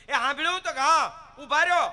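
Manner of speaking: high, loud calling wife. Retold or imitated. Wife calls her husband back from the kitchen, high and loud. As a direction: shout after him from the kitchen.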